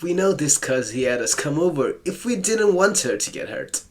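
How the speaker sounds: ordinary talk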